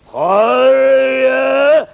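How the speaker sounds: high, loud drawn-out call; long loud yell. Acted. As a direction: call out across the village. Father calling from inside.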